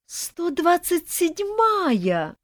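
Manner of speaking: slow, high exasperated drawl; eye-rolling. A narrator's reading. First ghost wife scoffs at the latest bride, slow and exasperated. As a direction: roll your eyes at the newest bride. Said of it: "закатила глаза первая".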